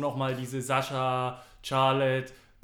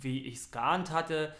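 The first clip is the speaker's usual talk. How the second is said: ordinary talk, nothing marked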